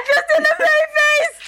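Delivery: high, slow mock cry of the phrase; mock echo. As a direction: stretch it out way up high, teasing. Mock echo of friend's claim.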